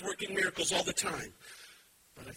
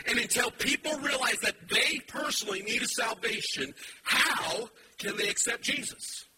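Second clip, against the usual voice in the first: loud, rising preacher's call; fiery sermon voice; full-force preaching; 6 semitones higher, 4 dB louder than usual. Acted. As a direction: drive it home to the congregation.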